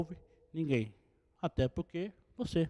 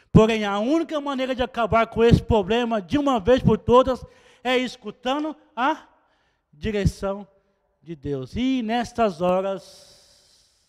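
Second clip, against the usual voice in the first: louder, emphatic preaching; 3 semitones higher, 9 dB louder than usual. Acted. Preacher insisting to the church on hearing God's direction, loud and emphatic. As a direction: proclaim it as the only way out.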